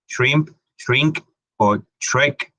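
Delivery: shrimp, shrink and shrek said slowly with a clear SHR; SHR cluster. Shown as a demonstration. Teacher showing the triple consonant in shrimp, shrink and shrek. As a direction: stretch out the SHR at the start of each word. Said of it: "como S H R".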